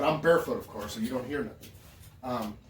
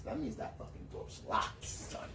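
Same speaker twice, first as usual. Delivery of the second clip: tense whisper; 11 semitones higher, 11 dB quieter, slower than usual. Retold or imitated. Tense moment at the bathroom door.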